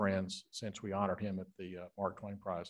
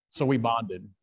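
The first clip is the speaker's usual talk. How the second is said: ordinary talk, nothing marked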